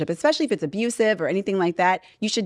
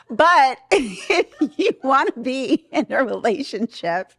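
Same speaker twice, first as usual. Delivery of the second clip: laughing through the words, slow; 3 semitones higher, slower than usual. Real, not acted. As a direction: still laughing as you say it. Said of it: "we were laughing here at the studio".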